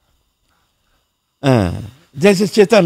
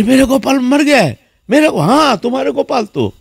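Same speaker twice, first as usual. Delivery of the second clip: a mother hearing her son is dead, gasping; shocked cry; 9 semitones higher than usual. Retold or imitated. Mother hears Gopal died, shocked high cry.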